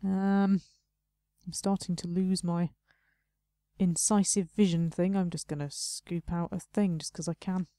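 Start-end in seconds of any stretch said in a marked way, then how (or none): none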